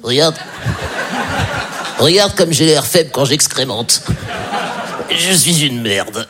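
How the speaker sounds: slow, feeble voice for a pitiful dog; trembling cowed dog. Acted. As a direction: drag it out like a pathetic, cowering pet. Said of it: "qui tremble et qui est là".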